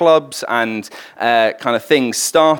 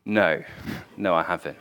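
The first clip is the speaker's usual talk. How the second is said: softer, small guilty admission; ashamed quiet no; 7 semitones lower, 9 dB quieter than usual. Retold or imitated. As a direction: own up quietly. Bill ashamed under questioning.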